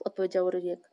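plain reading voice

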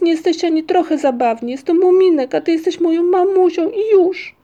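high, loud indignant insistence; exasperated acted shout, pitched right up. A narrator's reading. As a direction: insist at the top of a child's voice. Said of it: "krzyknął Muminek".

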